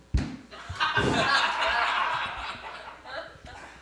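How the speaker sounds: high breathless puppet giggle; puppet cackle. Acted. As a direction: cackle like the dummy. Dummy laughs.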